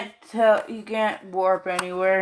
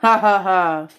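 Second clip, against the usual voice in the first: laugh; 6 dB louder than usual. Real, not acted. Laughing at own rhyme.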